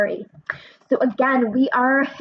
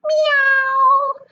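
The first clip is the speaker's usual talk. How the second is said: much higher, drawn-out cute kitty meow; cat meow; 5 dB louder than usual. Acted. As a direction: meow like a little cat calling out. Story cat makes strange sound.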